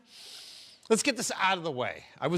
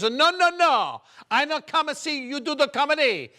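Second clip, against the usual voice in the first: high, loud broken-English Italian mama; mother's Italian accent; 8 semitones higher, 4 dB louder than usual. Retold or imitated. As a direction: play the Italian mother, pleading no. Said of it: "she's got the accent".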